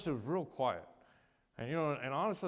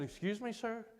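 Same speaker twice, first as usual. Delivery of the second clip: higher-pitched startled question; taken aback; 9 semitones higher than usual. Retold or imitated. Patient answering the father's challenge, taken aback. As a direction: question a superior in disbelief.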